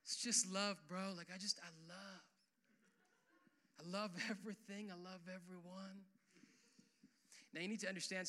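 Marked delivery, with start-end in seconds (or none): 0.0-6.1 lightly voiced laid-back surfer dude; a mild mock hippie, easy and chill; hippie Jesus. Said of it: "hippie Jesus says".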